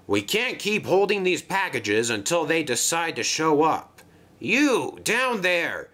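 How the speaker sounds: impatient dock boss voice; Cranky, harried crane. Acted. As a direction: order everyone about the busy docks.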